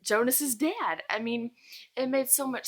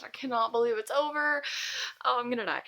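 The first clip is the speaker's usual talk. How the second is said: ordinary delivery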